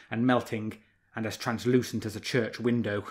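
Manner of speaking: ordinary delivery, nothing marked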